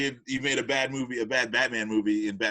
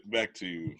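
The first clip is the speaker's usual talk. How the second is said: low, soft, slow dirge of a voice; mock somber; 8 semitones lower, 7 dB quieter, slower than usual. Steering the chat back to the deaths, mock-somber hush. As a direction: drop into a put-on mournful hush.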